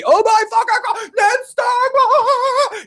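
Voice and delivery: high, loud screaming disbelief; shocked screaming. Retold or imitated. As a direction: scream as if seeing Ned die. Mimicking shocked reaction-video viewers.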